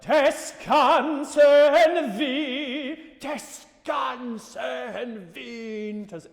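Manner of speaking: slow, sung, crisp consonants; "Des ganzen Winters" with hard, clear consonants, slow; big consonants. Shown as a demonstration. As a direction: spit out every consonant. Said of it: "still needs those consonants in it".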